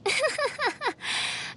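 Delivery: high, rhythmic theatrical chuckle; a string of self-important ha-ha-has; haughty laugh. Acted. Princess about to proclaim her titles, haughty laugh.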